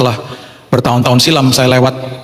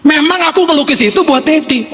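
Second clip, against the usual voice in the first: put-on high, earnest little kid; child's voice; 14 semitones higher than usual. Child tells Dad the painting was for him, high childlike voice. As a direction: pitch it way up like a child confiding. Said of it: "anak saya masuk".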